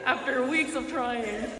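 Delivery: slow, laughing through words. Real, not acted. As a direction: laugh it out, breathless. Laughing after scare.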